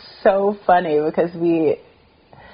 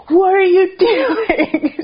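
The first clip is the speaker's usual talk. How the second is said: high and slow, a hammed-up indignant question breaking into a laugh; playing the friend's outrage but cracking up; mock outrage while laughing; 14 semitones higher, 3 dB louder, much slower than usual. Acting friend's angry beer quarrel.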